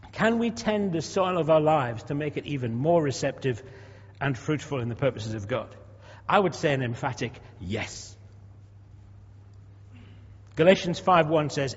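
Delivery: ordinary talk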